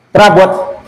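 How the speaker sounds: normal talking voice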